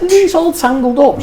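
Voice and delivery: höher, ungläubig gefragt; high, suspicious question; incredulous question. Retold or imitated. Retold question to wife.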